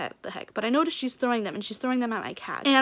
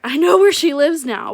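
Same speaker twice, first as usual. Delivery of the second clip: much louder, higher burst of indignation; loud outburst; 5 semitones higher, 12 dB louder than usual. Real, not acted. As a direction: blurt it out loudly with a laugh. Venting, mock-menacing realisation.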